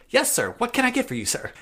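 put-on high, servile errand boy voice; Peppy. Errand boy answering a superior, high and deferential. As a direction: snap back to squeaky and eager to please. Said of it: "he immediately reverts back".

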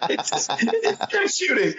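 squeaky high laugh through the words. Real, not acted. Retelling shooting shirts at one fan, laughing. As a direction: laugh it out, amused at yourself. Laughing at his own mishap.

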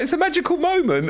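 high, dreamy inner voice; gushing over a magical moment. Retold or imitated. Mock dreamy inner thought.

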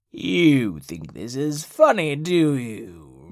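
slow villain cat through squashed lips; through pursed lips. Acted. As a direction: speak with lips squeezed. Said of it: "meowed through pursed lips".

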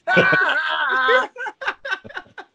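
high, drawn-out burst of laughter; hearty laugh. Real, not acted. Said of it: "we all just plain laugh".

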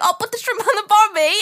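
high, laughing Australian send-up; mock Australian accent. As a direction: throw their accent back, hammed-up Australian. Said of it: "we're like".

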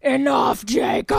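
booming wolfpack alpha command; werewolf. Retold or imitated. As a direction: shout it like the pack alpha. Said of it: "Sam puts on his Wolfpack voice effect".

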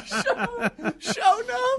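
squeaky high laugh through the words; cracking up while echoing him. Real, not acted. Cohost laughing at story.

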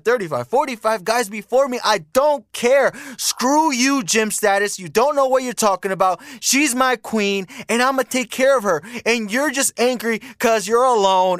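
high-pitched, worked-up imitation of an indignant man; mock defensive rant. Man defending his "queen" to his critic, mock indignant rant. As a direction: mimic a man hotly defending his girlfriend.

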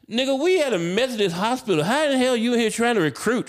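high, loud retold outburst; indignant comic tirade, high and loud; losing it. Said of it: "I have lost my shit".

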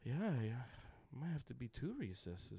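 low, soft mock agreement; lenient teacher. Teacher settles the kid's punishment, softly imitated. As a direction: mutter it like a mild teacher mulling it over.

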